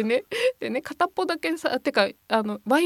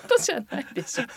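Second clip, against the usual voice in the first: fast, high laughing objection; laughing protest; 5 dB louder, much faster than usual. Real, not acted. Laughing protest at friend's joke.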